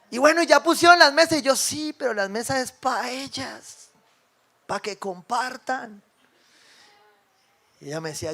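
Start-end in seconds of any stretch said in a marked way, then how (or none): 1.5-6.0 slowed-down, lightly acted explaining; slow patient correction. Pastor to the ladies setting tables, slow and patient. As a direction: spell it out slowly, like a patient pastor.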